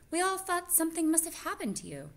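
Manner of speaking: put-on high child voice. Janice greets Mrs Frisbee.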